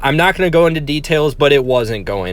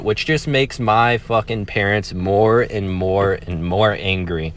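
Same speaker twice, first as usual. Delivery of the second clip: ordinary talk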